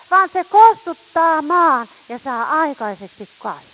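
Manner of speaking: fiery preacher calling down the word; full-force preaching. Acted. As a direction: proclaim it to the whole hall.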